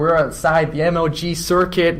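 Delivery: hammed-up American commentator voice; American accent. Shown as a demonstration. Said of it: "try an American accent".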